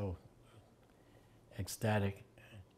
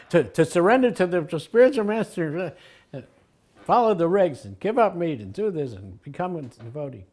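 mock earnest answer; 6 semitones higher, 7 dB louder than usual. Mock long preachy answer.

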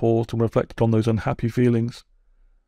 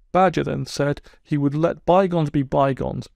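ordinary delivery

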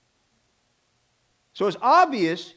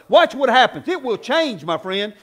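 normal talking voice

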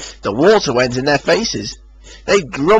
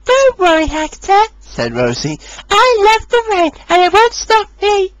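high bright little engine; cheerful Rosie. A narrator's reading. Rosie breezily reassuring Hector, high voice.

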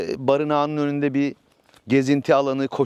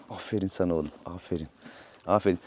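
hushed, tender praise, much softer; 7 semitones lower, 9 dB quieter, faster than usual. Real, not acted. Owner gently praising his new horse, hushed and tender. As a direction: murmur praise to calm him.

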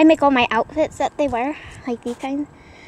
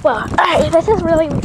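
excited; 4 semitones higher, 6 dB louder than usual. Real, not acted. Kid cheering on a trampoline.

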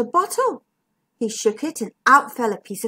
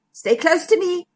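mildly raised, steady reassurance; brave; 6 semitones higher than usual. A narrator's reading. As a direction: say it with a touch of bravery. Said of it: "said Zigbee bravely".

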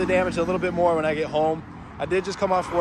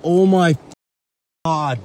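shocked cry; 6 dB louder, slower than usual. Real, not acted. Reacting during the crash.

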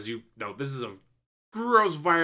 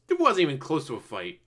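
ordinary talk, nothing marked

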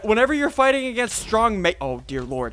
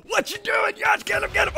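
high frantic urging; thrilled high-pitched calls; excited squealing; 12 semitones higher than usual. Real, not acted. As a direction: cheer the attack on way up high. Cheers on attack in game.

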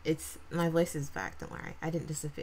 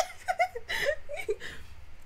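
high-pitched giggling burst; real laughter. Real, not acted. Laughs at search result.

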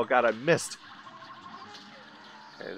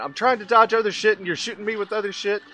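high, loud, exasperated rant; real gamer frustration, raised voice; angry outburst; 6 semitones higher, 6 dB louder than usual. Real, not acted. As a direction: burst out on the first word, then rant on. Rages at being shot.

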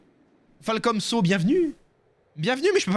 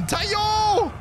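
cri de guerre. Acted. Cri de charge dans la bataille.

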